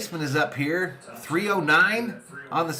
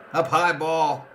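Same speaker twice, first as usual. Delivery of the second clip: normal talking voice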